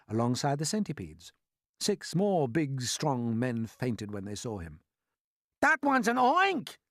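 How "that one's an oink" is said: high, loud, stretched-out shriek; scream. A narrator's reading. Fire chief spotting a second monster, screaming. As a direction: scream in alarm at the creature. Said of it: "screamed the head of the fire department".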